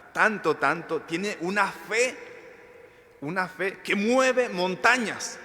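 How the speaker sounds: slow, high and loud proclamation of faith; fervent preacher to the congregation; impassioned preaching. Acted. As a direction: build it up like moving mountains. Preaching climax.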